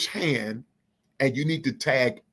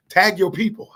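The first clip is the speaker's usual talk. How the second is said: higher and louder, calling out to the crowd; rallying call; 6 semitones higher, 5 dB louder than usual. Real, not acted. Host urging livestream viewers to tag their people, loud rallying call. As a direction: call it out to get everyone moving.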